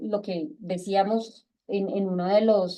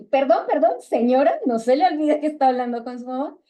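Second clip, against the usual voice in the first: higher, louder indignant mother scolding; mock stern scolding; 4 semitones higher, 5 dB louder than usual. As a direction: put the rude child in her place. Madre regaña a hija respondona. (regaño de madre indignada, más agudo y fuerte)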